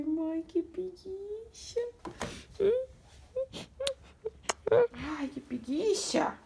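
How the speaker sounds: put-on high, soft, slow voice speaking for the baby. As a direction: voice the sleepy baby in a tiny soft voice. Fala pelo bebê, voz fininha. (voz de bebê fininha e suave, falando pelo nenê)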